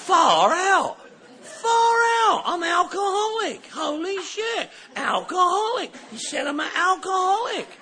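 overjoyed revelation; excited realization. Retold or imitated. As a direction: shout it with delight. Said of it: "joyful realization".

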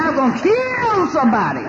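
high, loud, laughing through the threat; telling how she guarded the Frigidaire, laughing; laughing mock threat. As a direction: joke about killing over the fridge, cracking up. Laughing threat about the fridge.

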